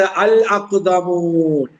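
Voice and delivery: അൽ അഖ്ദമൂൻ drawn out slowly, each syllable spelled clearly; slow dictation. Shown as a demonstration. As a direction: read the word out slowly for the class. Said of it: "teacher dictates Quran word slowly". (അൽ അഖ്ദമൂൻ പതുക്കെ, ഓരോ അക്ഷരവും വ്യക്തമായി)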